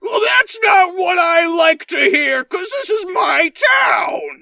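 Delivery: a whiny, crying yell; Adam Sandler-like yell. Pretend. Camden claiming his town, whiny tearful shout. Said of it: "Camden sounds like Adam Sandler".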